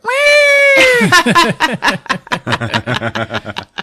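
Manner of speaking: very high, loud "whee"; imitated tiny bird squeal. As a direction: squeal like a thrilled little bird. Imitates tiny bird beside Chewie.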